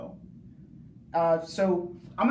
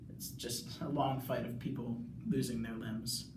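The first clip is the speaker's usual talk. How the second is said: usual speaking voice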